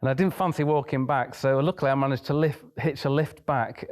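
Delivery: usual speaking voice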